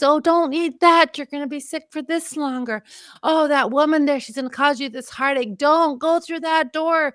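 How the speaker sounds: high, pleading, rushing to warn; rapid-fire advice to her younger self; high urgent pleading; urgent warnings. Retold or imitated.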